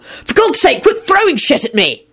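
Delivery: high-pitched, alarmed complaint; yelled protest. A narrator's reading. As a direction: yell it as if dodging thrown objects. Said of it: "Lupin yelled".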